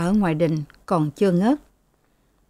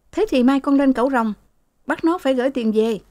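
defiant son; 6 semitones higher, faster than usual. A narrator's reading. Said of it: "Thằng Mão đáp".

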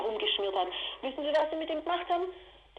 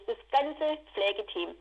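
ordinary delivery

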